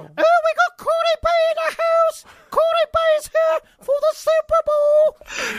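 very high, slow falsetto lady voice; a shrieky Python housewife, drawn out; Monty Python women. Retold or imitated. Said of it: "talk like the Monty Python women".

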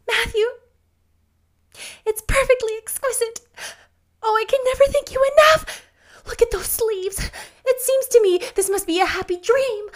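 very high, loud, rapturous voice; overjoyed gushing girl. Acted. Anne thanks Matthew for the dress, enraptured.

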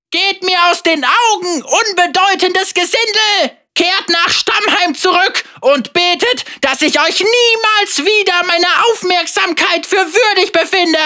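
hoch und laut verjagend; verächtlich fortschicken; herrischer Befehl. Acted. Villain dismisses villagers. (herrisch verächtlicher Bösewicht)

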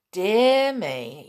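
ordinary delivery

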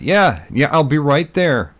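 high, loud, drawn-out call. A narrator's reading. Pretending to answer someone off screen, calling out. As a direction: call off to someone waiting across the room.

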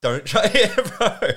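laughing. Real, not acted. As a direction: laugh it out with a friend. Laughing at his own story.

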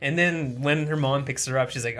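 ordinary talk, nothing marked

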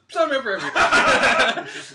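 overeager, high and drawn-out; loud, cocky volunteering with a laugh in it; gung-ho. Retold or imitated. Athlete signing up for all events, gung-ho. Retold overconfident sign-up, with laugh.

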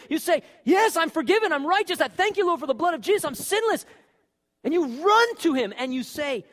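loud, high preacher's delivery; fired-up sermon voice; full-force preaching. Acted. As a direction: preach it out to the whole room.

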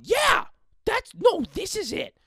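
loud, high cartoon mother arguing; imitating mom's combative squawk; argumentative mom. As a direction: agree as if in a fight. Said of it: "talking like we're arguing".

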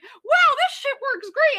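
high, loud, gushing delight; excited. Retold or imitated. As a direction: rave about it in surprise. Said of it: "and she's like".

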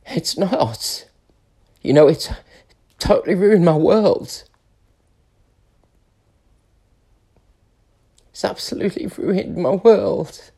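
real tearful distress, high and halting; crying. Real, not acted. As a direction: break down in tears between long pauses. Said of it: "Why the fuck am I crying?".

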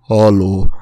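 put-on loud, low one-word Indian-accented greeting; thick Indian accent. Imitating the guy at the till, thick Indian accent. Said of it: "had the thickest Indian accent".

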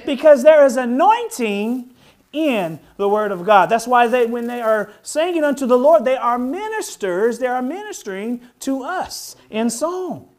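fervent high, loud preaching. Acted. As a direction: preach it with rising fire. Preaching at full force.

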